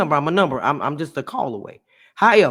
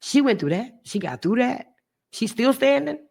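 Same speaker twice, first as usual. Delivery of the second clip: high, rising stunned questions; audience disbelief; 9 semitones higher than usual. Retold or imitated. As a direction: voice the listeners' shock. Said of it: "y'all was gonna be like".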